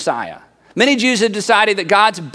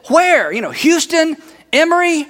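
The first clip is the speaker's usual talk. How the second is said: much higher, hopeful guesses; eager questioning; 8 semitones higher than usual. Acted. Relative asks where the cure is, eager. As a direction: jump on the news with eager questions. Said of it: "And you said".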